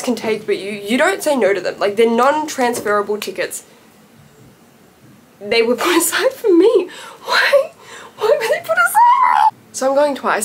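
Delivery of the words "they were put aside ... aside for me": high, laughing squeal; giddy laughing disbelief. Real, not acted. Fan telling viewers about tickets, laughing. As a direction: laugh out the question in delight.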